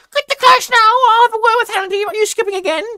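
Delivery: high, loud scolding janitor. A narrator's reading. Janitor scolding a skipping student, high shrill shout. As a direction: snap at the kid like a cranky janitor.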